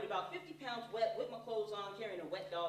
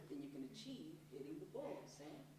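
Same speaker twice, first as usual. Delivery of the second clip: dad's advice; 2 semitones lower, 9 dB quieter than usual. Retold or imitated. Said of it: "Do you believe".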